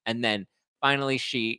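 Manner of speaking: usual speaking voice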